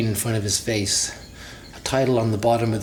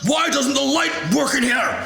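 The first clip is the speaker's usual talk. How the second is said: scream. A narrator's reading. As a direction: shriek it from down the hall. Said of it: "he screams".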